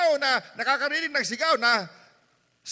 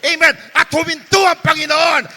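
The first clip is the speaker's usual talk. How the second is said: loud, fervent, sing-song preaching; preaching at full force; 6 semitones higher, 12 dB louder than usual. Acted. Pastor urging the church to keep praying, fervent shouted preaching. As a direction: drive it home to the congregation.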